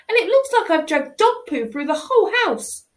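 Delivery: ordinary delivery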